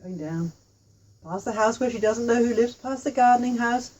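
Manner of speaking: usual speaking voice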